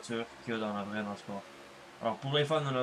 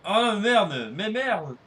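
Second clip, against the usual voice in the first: frustrated cursing; 5 semitones higher, 9 dB louder than usual. Real, not acted. Crash in race, cursing.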